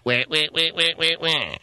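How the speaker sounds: far-off wah cries; mock animal wailing; distant animal wah. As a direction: copy the creature's call from afar. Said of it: "three hundred feet".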